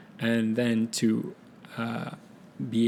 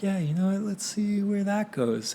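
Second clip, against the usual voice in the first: breezy mock musing; 9 semitones higher than usual. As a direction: voice a carefree thought in a raised, leisurely lilt. Acting out own carefree thought on projects.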